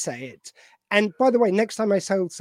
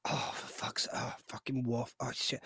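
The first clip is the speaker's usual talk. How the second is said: hushed, breathless mock whisper from the throne; whispered Kempek; 8 semitones lower, 12 dB quieter than usual. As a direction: mutter it under your breath like a weary old chancellor. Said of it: "Kempek's there in his throne going".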